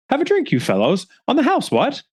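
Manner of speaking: a cheery host offering a round, lightly hammed; jovial colonel. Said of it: "so the colonel goes inside to fetch them some drinks".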